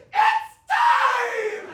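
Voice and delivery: high, loud melodramatic shriek; child's mock labor shout. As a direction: cry out like a kid faking labor. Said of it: "She'd spill it on the floor and go".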